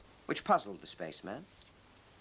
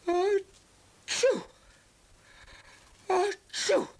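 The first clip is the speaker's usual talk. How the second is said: two high, loud sneezes; exaggerated storybook sneeze, twice; big sneezes; 6 dB louder, much slower than usual. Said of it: "he sneezed".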